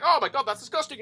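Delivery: high-pitched imitation of shocked complaining; mock dismay. Mimicking people shocked once the law passes, mock dismay.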